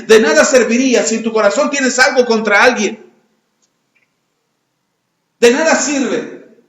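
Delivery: preaching at full force, pressing the point; fervent preaching. Acted. Preacher urging the congregation to forgive, fervent. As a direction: drive the call to forgive home to the congregation.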